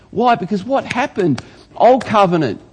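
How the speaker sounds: ordinary delivery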